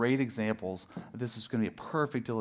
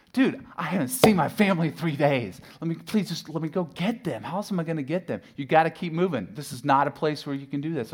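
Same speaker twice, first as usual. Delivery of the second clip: loud, higher, frustrated plea; frustrated yelling; 5 semitones higher, 6 dB louder than usual. Retold or imitated. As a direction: yell out the car window at the cop. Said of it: "I'm yelling at this one out the window".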